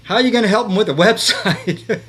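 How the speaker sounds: laughing disbelief. Retold or imitated. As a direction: imitate someone laughing at a silly plan. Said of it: "He started laughing at me".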